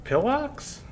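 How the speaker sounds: puzzled question. Real, not acted. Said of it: "I don't know what you're saying".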